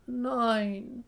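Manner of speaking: high, loud, drawn-out whine; sobbing chick. A narrator's reading. Chick refuses to break the shell, sobbing. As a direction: wail it like a tearful chick. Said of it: "schluchzt das Küken".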